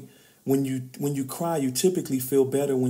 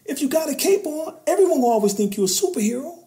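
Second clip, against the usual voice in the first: raised, loud, urgent sermon voice; preaching at full force; 10 semitones higher, 6 dB louder than usual. Real, not acted. Speaking to men in the audience, fired-up preaching. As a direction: drive the point home like a preacher.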